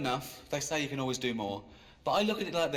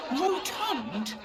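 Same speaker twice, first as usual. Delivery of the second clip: high, loud offended protest; mock outrage; 10 semitones higher, 3 dB louder, slower than usual. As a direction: repeat the insult in disbelief. Outraged at the word rotund.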